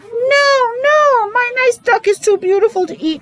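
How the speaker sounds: put-on high, pleading child's voice; a little boy begging to save his duck; boy crying out. Boy begs his parents not to eat the duck, pleading high. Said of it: "cried the boy".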